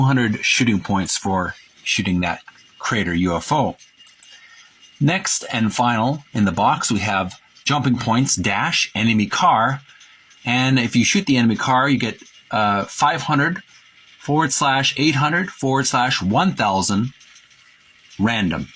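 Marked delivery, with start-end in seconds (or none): none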